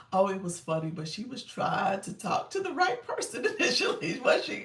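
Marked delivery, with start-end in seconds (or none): none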